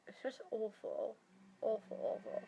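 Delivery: quiet, heavy groaning repeat; fed up, blocked nose. Real, not acted. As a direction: trail off into a soft moan. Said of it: "It's so blocked up".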